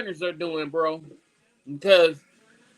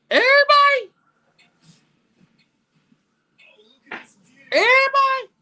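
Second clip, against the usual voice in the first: very high, loud strained wail; anguished scream; 6 dB louder, faster than usual. Real, not acted. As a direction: scream it in despair. Screaming about the whole team gone.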